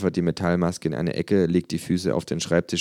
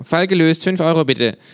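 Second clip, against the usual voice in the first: much higher, louder case-closed line; smug kangaroo; 11 semitones higher, 7 dB louder than usual. Acted. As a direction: rattle it off like a smug detective. Känguru voiced by narrator.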